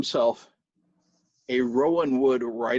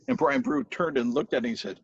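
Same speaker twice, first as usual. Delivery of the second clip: plain reading voice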